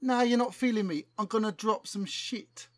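lightly voiced London rapper, keen; a hint of a slangy London rapper; street rapper voice. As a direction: talk like a cocky MC pitching a hit. Plays rapper Chronic Cortex.

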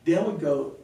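ordinary delivery

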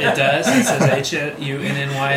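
laughing through the words. Real, not acted. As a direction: laugh while talking. Laughing while confirming the joke.

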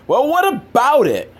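high, cheeky comeback; mock snappy retort. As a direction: toss the question back sarcastically.

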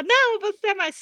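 mock the preachy young activist; mock accusing retort. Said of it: "Daí você fala".

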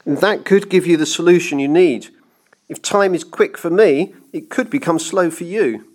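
high, pressing clockmaker voice; urgent persuasion. A narrator's reading. Clockmaker bargaining with the banker to buy time, urgent. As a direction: raise pitch and volume, push the argument home. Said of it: "the clockmaker continued quickly".

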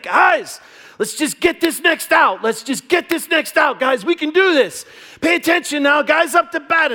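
put-on high, loud kid chatter to teammates; pep up the team like an eager boy; cheering little leaguer. Right fielder rallying his teammates, loud eager kid cheer.

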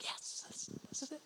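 breathless secret whisper of victory; excited whisper. Pretend. A kid celebrating a yes for a sleepover, hushed whisper. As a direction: whisper it like a kid who just won.

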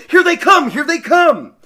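high, loud excited shout. A narrator's reading. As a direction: yell the warning across the field. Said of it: "Both of them were shouting".

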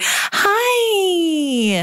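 put-on grown-up voice for the parent; babysitter voice. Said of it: "a babysitter voice".

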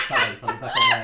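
talking fast through laughter; words squeezed out mid-laugh. Real, not acted. Laughing after mother's joke.

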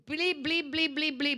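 bleeped-out swearing as nonsense syllables; playful censored-swearing gibberish; mock cursing babble. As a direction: stand in for curse words with rapid mock bli sounds. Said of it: "una persona que habla malo".

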